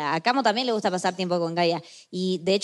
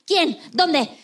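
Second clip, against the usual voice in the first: ready to fight; 8 semitones higher than usual. Retold or imitated. Friend imitates Luz ready to defend her, mock fired-up. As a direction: play the friend itching for a fight. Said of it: "Ya se quiere ir a pelear".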